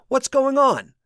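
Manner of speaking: high, loud urgent question; alarmed question. A narrator's reading. Josh pushing to the porch, alarmed. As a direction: demand answers in alarm.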